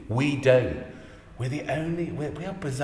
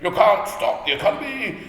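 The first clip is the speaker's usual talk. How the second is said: much higher, louder mock voice of a know-it-all; pompous naysayer; 13 semitones higher, 6 dB louder than usual. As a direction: lampoon a smug doubter, squeaky and loud. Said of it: "imagine it was someone like this saying".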